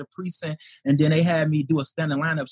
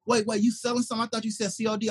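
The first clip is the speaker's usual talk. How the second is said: higher, puzzled double take; 6 semitones higher than usual. Retold or imitated. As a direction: catch the trick, pitch up. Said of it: "he was like".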